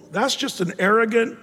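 ordinary talk

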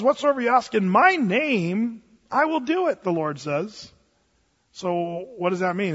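ordinary talk, nothing marked

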